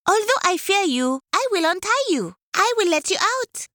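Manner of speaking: high, quick monkey; nervous but kind, high and quick; high, fast monkey freeing him. Acted. Monkey frees leopard.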